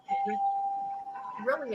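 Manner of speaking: real grief catching in the throat; voice breaking. Real, not acted. Speaking of a victim's children, voice breaking with real grief. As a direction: voice cracks high and slow on the word. Grieving over Jacob Blake's children.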